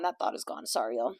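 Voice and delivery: unvoiced whisper, trailing off. Real, not acted. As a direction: whisper it to yourself. Trailing off, lost the thought.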